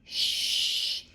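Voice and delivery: sharp whispered shh; frightened hiss. A narrator's reading. Husher hushing Lenny in fright, a whispered shh. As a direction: hush him in a panic. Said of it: "hissed Husher in fright".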